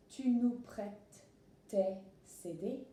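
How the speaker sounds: slow, careful French question; slow French reading. Shown as a demonstration. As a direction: read the French slowly for learners. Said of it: "let's look at the next example".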